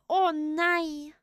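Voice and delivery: put-on high-pitched kids' show teddy bear; cartoon teddy bear. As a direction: imitate a weary little teddy, high and drawn out at the end. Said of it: "I sound like that teddy bear".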